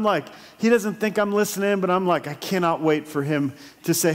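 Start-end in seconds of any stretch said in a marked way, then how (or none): none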